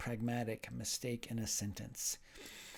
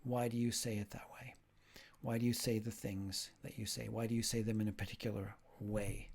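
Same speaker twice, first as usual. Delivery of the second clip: hushed whisper, softer; whispered questions; 2 semitones lower, 5 dB quieter than usual. Acted. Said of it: "pragmatics asks".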